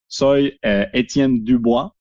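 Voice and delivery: ordinary delivery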